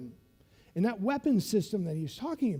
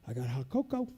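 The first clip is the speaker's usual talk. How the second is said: ordinary delivery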